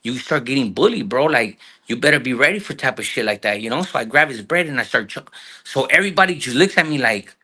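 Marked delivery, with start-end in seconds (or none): none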